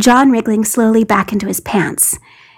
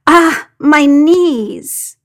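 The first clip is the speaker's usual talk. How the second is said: slow, higher groan of pain; pained cry; 5 semitones higher, 4 dB louder, much slower than usual. A narrator's reading. John crying out as the human pyramid collapses. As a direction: drag it out, hurting. Knees buckled in a heap.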